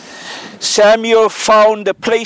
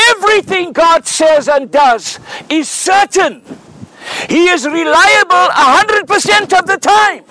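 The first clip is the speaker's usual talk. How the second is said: preaching; 8 semitones higher than usual. Acted. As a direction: preach it out with fire. Preaching at full force.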